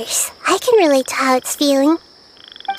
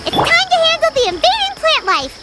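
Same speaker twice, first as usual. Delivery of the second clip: excited companion voice; 10 semitones higher than usual. Acted. Companion cheering on progress.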